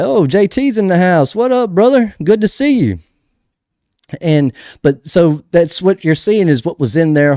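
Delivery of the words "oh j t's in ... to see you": high excited shout-out; excited greeting. Real, not acted. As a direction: greet a friend happily, much higher. Greeting a viewer joining.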